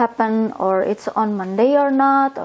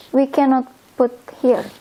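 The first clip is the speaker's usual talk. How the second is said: normal talking voice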